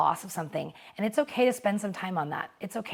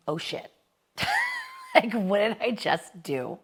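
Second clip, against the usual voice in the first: mock panicked laugh; 4 semitones higher, slower than usual. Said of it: "something like".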